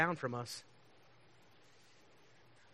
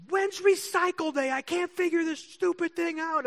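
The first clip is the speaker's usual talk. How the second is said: much higher, slow, exasperated mock voice; imitating flustered neighbor; 6 dB louder, slower than usual. Neighbor who can't figure out trash day, high exasperated mock voice. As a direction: squeak it out like a frazzled neighbor.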